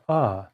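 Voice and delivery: short "uh" instead of ah; schwa sound. Shown as a demonstration. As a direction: model the weak uh. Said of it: "pronouncing this word as ah or".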